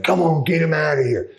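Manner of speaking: a mock high plea from the girls; imitating the girls. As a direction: play the girls begging him to get the general out. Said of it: "the girls come down".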